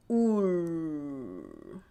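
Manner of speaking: 울 said slowly, low and soft; drawn-out 울. Shown as a demonstration. Teacher models the 울 vowel for students, slow and low. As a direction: stretch out 울 gently. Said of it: "This consonant doesn't have any sound".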